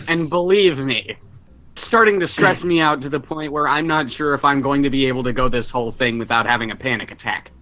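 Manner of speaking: ordinary talk, nothing marked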